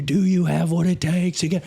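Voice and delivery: instructor's challenge. Retold or imitated. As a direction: challenge the tired swimmer to prove himself. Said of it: "and he was like".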